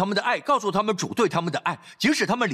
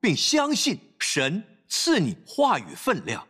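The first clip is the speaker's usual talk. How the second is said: slow and calm; slower than usual. Shown as a demonstration. Preacher models calm speech.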